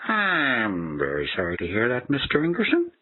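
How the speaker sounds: pity-me apology on repeat; whiny scripted agent. Retold or imitated.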